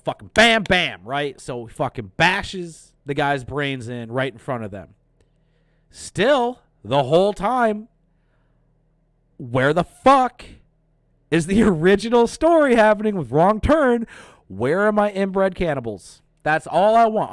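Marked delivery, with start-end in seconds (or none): 0.0-0.6 loud, high punchy bam. Retold or imitated. Acting out the killing blow, loud and punchy. 5.9-17.3 loud, high rant with a laugh; exasperated rant. Real, not acted. Complaining to viewers about the movie, loud and high. Ranting, wants the cannibals.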